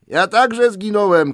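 high, loud shout. A narrator's reading. Lost Bilbo shouts back at Gollum. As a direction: yell it across the dark. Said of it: "krzyknął Bilbo".

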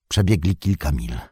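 ordinary delivery

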